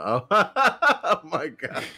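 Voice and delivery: slow, laughing oh my God; giggling. Real, not acted. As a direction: laugh through the words. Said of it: "I got the giggle".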